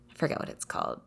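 half-whispered aside; breathy murmur to herself. Real, not acted. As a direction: drop to a whisper to yourself while searching for the name. Muttered aside while trying to remember.

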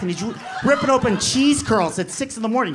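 ordinary delivery

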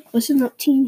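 ordinary delivery

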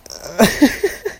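real wheezing laughter; helpless laugh. Real, not acted. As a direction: crack up and laugh it out. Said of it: "I'm sorry for laughing".

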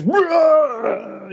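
long, high-pitched mock roar; drawn-out monster roar impression; mock demon roar. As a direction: imitate a demon roaring. Imitates demon roaring at them.

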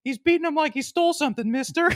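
put-on high little girl voice. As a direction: pitch it up like a kid. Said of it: "the little girl goes".

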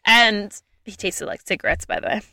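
ordinary talk

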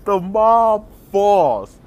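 slow, drawn out and loud; slow mock-epic announcement; slow-motion drama. Shown as a demonstration. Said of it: "super dramatic, slow motion".

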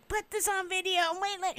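high but soft imitation of a tantrum; half-voiced, high-pitched mock yell; mock yelling customer. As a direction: mimic a ranting shopper without the volume. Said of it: "and yelling and even like".

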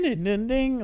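high sing-song doorbell dings; high-pitched imitated chime; doorbell chime imitation. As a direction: mimic the electronic doorbell jingle.

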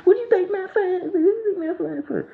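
very high, screechy grandma imitation; high-pitched, hammed-up wail of complaint; mock grandma voice. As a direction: mimic an upset grandma in a squeaky falsetto. Mimics grandma demanding her phone back.

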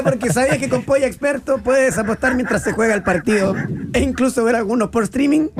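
ad read. Acted. Sponsor ad read live.

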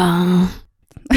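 drawn-out comic ガーン; slow shocked ガーン. Shown as a demonstration. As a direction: stretch out ガーン like a manga shock. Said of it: "またガーンって言ってる".

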